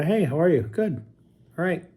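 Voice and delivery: imitating a casual, unemotional exchange; nonchalant. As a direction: toss it off lightly, no big feeling. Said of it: "nonchalant, non, very emotional".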